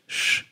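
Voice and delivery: soft, drawn hushing sh; quiet sh, no throat rasp; soothing sh. Shown as a demonstration. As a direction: let sh out as a gentle hush. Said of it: "rather soothing".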